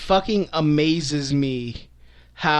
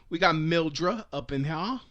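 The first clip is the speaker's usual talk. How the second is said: lower, softer put-on sassy voice; random sassy voice; 5 semitones lower, 7 dB quieter than usual. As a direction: slip into a silly sassy character. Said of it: "I don't know why I just said that".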